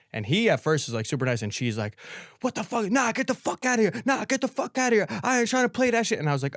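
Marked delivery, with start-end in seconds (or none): 2.4-6.2 mock screaming. Older woman chasing off a stranger, mock angry screech. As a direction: imitate an irate woman in a high voice. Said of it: "she starts screaming at me".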